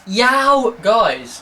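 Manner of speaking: "Yow, guys" in a hammed-up Wolverhampton voice. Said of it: "As if someone from Wolverhampton's gonna say".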